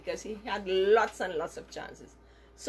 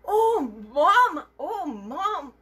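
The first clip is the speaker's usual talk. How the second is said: higher, mock-surprised child; feigned innocence; 8 semitones higher, 7 dB louder, slower than usual. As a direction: act like you've never seen the mess. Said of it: "Daryl pretended not to know".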